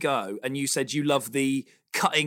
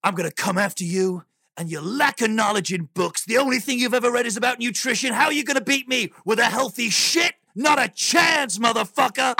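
wrestling voice; 6 semitones higher than usual. Pretend. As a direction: yell it like a wrestler hyping a match. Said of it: "you gotta get a wrestling voice going on".

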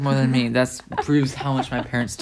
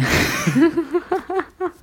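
bright burst of laughter; high laugh. Real, not acted. Guest laughing at his own joke about being French.

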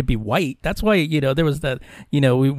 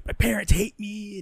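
high mock whiny teenager; lightly mocking moody kid; angsty teen; 6 semitones higher, 3 dB quieter than usual. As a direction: sulk it like a put-upon teen.

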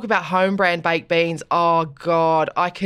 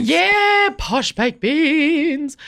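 slow, hammed-up posh drawl, pitched higher; mock posh voice; 7 semitones higher, much slower than usual. Joking about fancy Heinz beans, hammed-up posh drawl. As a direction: savour each word like a snob.

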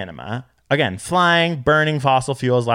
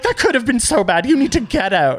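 high, urgent mock alarm; hammed-up alarmed instructor; retold panic; 10 semitones higher, 3 dB louder than usual. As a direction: scold in a panicked, pitched-up voice. Quoting the panicked surf teachers.

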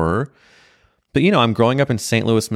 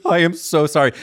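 mock apology; 8 semitones higher than usual. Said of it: "She goes".

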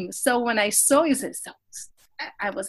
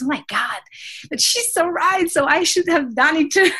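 excited realisation; 5 semitones higher, 6 dB louder than usual. Retold or imitated. Retells own realisation.

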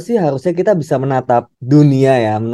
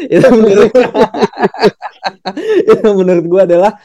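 laughing; 8 semitones higher, 4 dB louder, faster than usual. Real, not acted. Laughing about Ronaldo leaving.

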